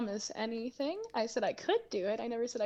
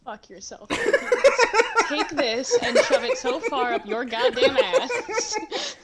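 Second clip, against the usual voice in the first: mock outrage through laughter, louder; laughing; 5 semitones higher, 11 dB louder than usual. Real, not acted. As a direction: curse through real laughter. Player overwhelmed, laughing.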